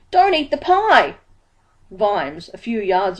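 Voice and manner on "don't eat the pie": high, loud call across the street; urgent shout, pitched high and loud. A narrator's reading. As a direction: shout to stop him in time. Dibbler calling out in panic.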